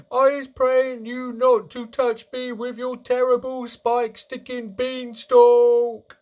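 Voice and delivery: high, loud wail of panic; screaming. A narrator's reading. Dreaming giant begging Jack to remove the beanstalk, screaming. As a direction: howl and beg, stretching the final word. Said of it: "screamed the flesh lump eater".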